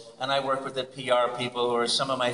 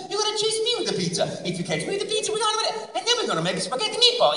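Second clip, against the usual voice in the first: very high, bouncy cartoon Italian plumber; Mario voice; faster than usual. Mario inviting the viewer to make pizza, very high excited voice. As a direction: play the jolly Italian plumber, laughing. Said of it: "I turn to the camera and I said".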